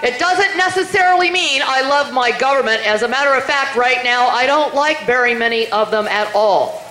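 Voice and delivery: usual speaking voice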